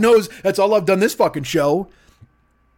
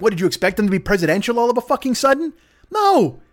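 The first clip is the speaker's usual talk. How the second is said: ordinary talk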